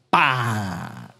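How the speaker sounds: a sharp low whip-crack sound. Acted. Preacher mimicking the whip on Asiya, sharp crack. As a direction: crack the whip with your mouth.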